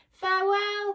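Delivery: high, loud called-out goodbye; crying farewell. Acted. Oz calling goodbye from the balloon, crying out. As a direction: shout it down from the rising balloon. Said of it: "cried Oz".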